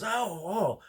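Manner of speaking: high, loud, amazed woman; excited lady. Retold or imitated. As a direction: gush like a starstruck shopper. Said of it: "And she goes".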